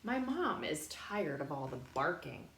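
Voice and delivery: plain reading voice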